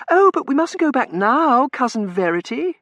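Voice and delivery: very high, loud wheedle; high-pitched, insistent protest; girlish pleading. A narrator's reading. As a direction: beg your cousin like a spoilt girl. Girl pleads to stay.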